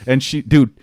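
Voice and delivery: ordinary delivery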